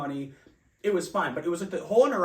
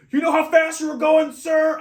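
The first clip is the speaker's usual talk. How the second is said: very high, loud barked cop question; stern cop; 13 semitones higher, 12 dB louder than usual. Retold or imitated. Officer at the car window, a loud high barked question. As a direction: shout it like a trooper at the window. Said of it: "the guy comes knocking on my window and goes".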